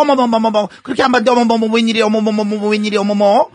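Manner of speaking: hammed-up gasping disbelief; shocked gossip. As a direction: play a scandalized neighborhood gossip, piling up the "oh my"s. Acted gossiping woman's shocked reaction.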